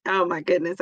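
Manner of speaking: ordinary talk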